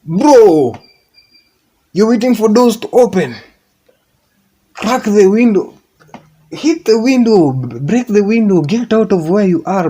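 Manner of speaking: high, loud, rising into a shout; shouting advice. Retold or imitated. Urging a stuck friend, high loud shouting. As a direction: yell at them to break out. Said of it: "Then I was like".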